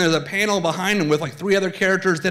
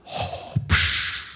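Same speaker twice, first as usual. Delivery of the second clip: Darth Vader; 5 dB quieter than usual. Acted. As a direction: breathe heavily through a villain's mask. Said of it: "Vader steps up".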